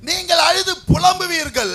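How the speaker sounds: loud proclamation. Real, not acted. Preacher declaring the verse to the church, loud proclamation.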